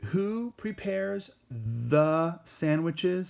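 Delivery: who prepares the sandwiches, drawn out slowly; slow teaching repeat. Shown as a demonstration. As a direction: spell it out slowly for the learners.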